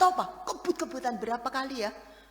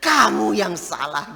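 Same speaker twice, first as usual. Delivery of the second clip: blaming retort; 11 dB louder than usual. Retold or imitated. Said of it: "Gitu kan ya?".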